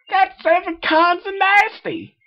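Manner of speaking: very high, loud, slow country yell; disgusted holler. Acted. Applejack disgusted by the secret, yelling very high. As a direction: shout it out in disgust.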